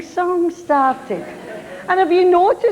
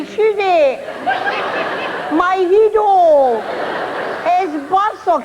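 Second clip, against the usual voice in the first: hammed-up polite tourist asking; mock polite tourist; 5 semitones higher than usual. Boy asking locals politely about Barcelona, mock-polite. Wee guy asks in bad Spanish.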